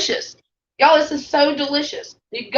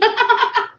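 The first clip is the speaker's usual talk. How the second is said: laugh; 12 semitones higher than usual. Real, not acted. Laughing at watching viewers.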